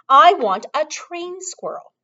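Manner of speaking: loud, high, slow bratty demand; spoiled demand. A narrator's reading. Veruca insisting to her mother, loud and slow. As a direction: draw the demand out like a brat.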